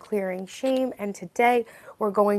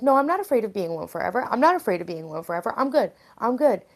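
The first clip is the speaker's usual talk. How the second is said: fast, louder protest; insistent denial; 3 semitones higher, 6 dB louder, faster than usual. Acted. Streamer acting out denying her fear of being alone, rapid insistence. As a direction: rattle it off in mock denial. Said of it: "it's easy to be in denial".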